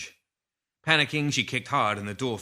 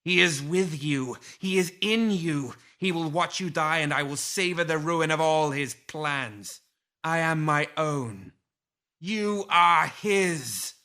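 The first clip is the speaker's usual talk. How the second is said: higher, slow, otherworldly fury; rage; 6 semitones higher, 3 dB louder, slower than usual. A narrator's reading. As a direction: spit out each word slowly in rage. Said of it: "Lilith's tone turned quickly to rage".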